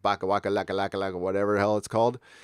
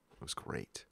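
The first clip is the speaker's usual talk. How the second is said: breathy whispered repeat, much softer; whispered echo; 6 semitones lower, 20 dB quieter than usual. Real, not acted. To the hosts, repeating it under his breath, whispered. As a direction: let it fade to a whisper.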